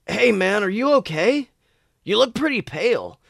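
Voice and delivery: casual worried buddy; Roger's concern. A narrator's reading. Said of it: "said Roger".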